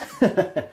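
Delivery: high burst of laughter at his own joke. Real, not acted. Vlogger laughing at his tax joke, high burst.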